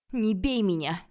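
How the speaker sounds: loud pleading scream; sudden yell. A narrator's reading. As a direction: burst out yelling as if being beaten. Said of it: "без перехода заорала я".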